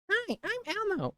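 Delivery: very high falsetto puppet voice; Elmo. Shown as a demonstration. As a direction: greet like a tiny children's puppet. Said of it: "I can do a pretty good Elmo".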